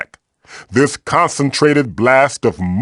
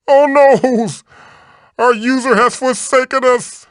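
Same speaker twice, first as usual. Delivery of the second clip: mock despair; 12 semitones higher than usual. Idle voice line, mock despair.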